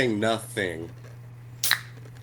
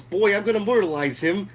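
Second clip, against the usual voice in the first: higher, goofy mock threat; hammed-up threat; 9 semitones higher than usual. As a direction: play a cartoonish thug winding up. Voicing the guy threatening Bill.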